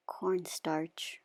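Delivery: whispered aside; whispering. Real, not acted. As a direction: breathe the word softly. Aside while measuring cornstarch.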